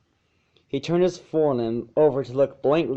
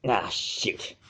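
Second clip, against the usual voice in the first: a soft, lower, annoyed grumble; grumbled; 4 semitones lower, 6 dB quieter than usual. A narrator's reading. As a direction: mutter it under your breath. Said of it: "he grumbled".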